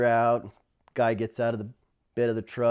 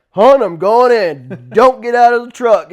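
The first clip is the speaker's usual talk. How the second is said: loud retold urgency; urgent call; 9 semitones higher, 13 dB louder than usual. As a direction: declare it firmly to his wife. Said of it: "I was like".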